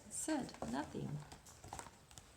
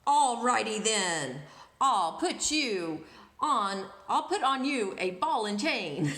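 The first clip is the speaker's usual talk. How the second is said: annoyed princess; 4 semitones lower, 8 dB louder than usual. Acted. Annoyed princess threatening parrot with ball and chain, lower and louder. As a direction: spell out the punishment with annoyance. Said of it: "the princess grew annoyed".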